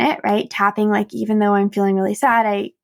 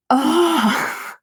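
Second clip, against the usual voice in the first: high, whimpering mock "oh"; retold waking groan; 6 semitones higher than usual. Vlogger reliving waking after bad sleep, mock whimper. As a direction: groan as if dreading the morning. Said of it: "I wake up the next morning".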